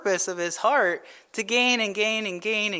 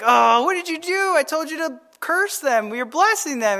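angry king; 7 semitones higher than usual. Retold or imitated. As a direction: scold him like a frustrated king. Balak scolds Balaam, retold.

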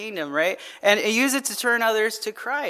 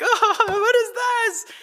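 very high, drawn-out mock whine; imitated dismayed son; 13 semitones higher, slower than usual. Father imitating his son getting half the deck, mock whining. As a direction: wail it like a child dealt a terrible hand.

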